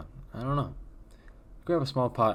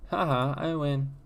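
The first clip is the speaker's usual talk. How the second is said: put-on higher, louder, slow little girl's gloat; Alice winning; 7 semitones higher, 4 dB louder than usual. Daughter cheering her trivia win, high and slow. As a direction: play the daughter gleefully claiming the win.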